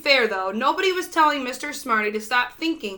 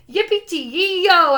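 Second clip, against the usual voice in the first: slow, louder whooping cheer; hollered cheer; 6 semitones higher, 6 dB louder, slower than usual. A narrator's reading. The whole town cheering Holler, loud slow whoop. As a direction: cheer for the hero. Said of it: "everyone hollered".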